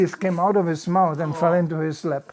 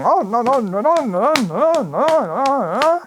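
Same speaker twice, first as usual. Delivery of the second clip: high, sing-song string of no; hammed-up happy farmers chorusing no; mock Swedish farmers; 6 semitones higher than usual. Simple Skåne farmers at midsummer, high sing-song no. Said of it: "and going".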